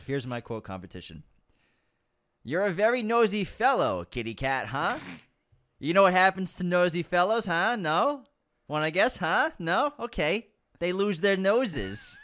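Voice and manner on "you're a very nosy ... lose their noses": menacing, playful thug taunting a nosy man; Polanski impression. Director taunting Jack with a menace. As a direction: lean in and toy with him. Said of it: "I like the impression, too. It's pretty spot on".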